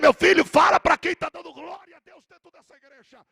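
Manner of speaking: high, rising prophetic preaching; fervent prophecy. Acted. The preacher prophesies to the church, fervent and high. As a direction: proclaim it like God speaking.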